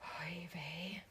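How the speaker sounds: hushed, whispered aside; whispered dismay. Real, not acted. Co-host reacts to friend alphabetizing numbers, whispered dismay. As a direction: whisper it under your breath.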